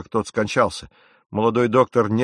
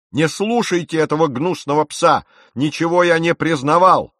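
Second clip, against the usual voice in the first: higher, louder furious outburst; angry exclamation; 7 semitones higher, 5 dB louder than usual. A narrator's reading. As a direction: shout down the traitor. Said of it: "воскликнул капитан". (гневный возглас капитана)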